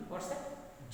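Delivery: usual speaking voice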